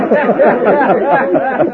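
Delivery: high, long, put-on braying laugh; braying mock laugh. Stranger mocks the gang leader's bray, braying laugh. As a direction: bray like a mule to mock him.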